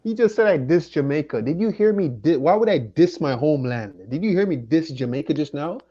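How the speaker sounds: loud, outraged denial to the chat; heated denial. Real, not acted. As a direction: fire back at the accusation.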